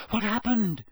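high, worried question; anxious. Acted. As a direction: call out in alarm. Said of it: "said Hermione anxiously".

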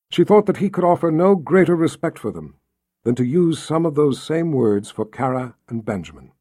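plain reading voice

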